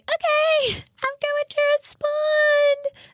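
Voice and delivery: high, drawn-out mock-exasperated reply; high-pitched, stretched-out "fine, I'll do it"; exaggerated compliance. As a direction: slowly sing it out high, giving in. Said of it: "I was like".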